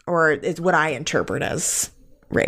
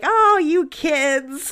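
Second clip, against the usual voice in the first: put-on slow, higher, amused old lady; a drawn-out granny tut, lightly mocking; 9 semitones higher, much slower than usual. The old lady outside the inn shrugging at the lovers, slow and higher. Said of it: "she's just like".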